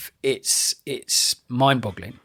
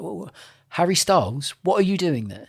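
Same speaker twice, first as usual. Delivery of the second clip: mock scolding; 6 semitones higher than usual. Mocking [name]' casting.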